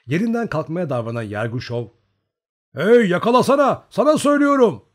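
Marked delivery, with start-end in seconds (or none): 2.7-4.8 high, loud urgent yell; shouting. A narrator's reading. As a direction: shout a command across to someone. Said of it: "diye bağırdı tüfeğini yakalayarak".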